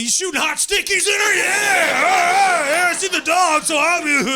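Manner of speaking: high shouting bro voice; excited yell. Retold or imitated. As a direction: holler it like a rowdy pub guy. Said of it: "you're like".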